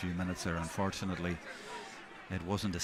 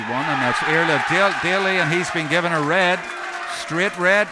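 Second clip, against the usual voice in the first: much higher and louder, bursting out; excited; 7 semitones higher, 12 dB louder than usual. Real, not acted. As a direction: call the red card in a rush of excitement. Straight red card shown.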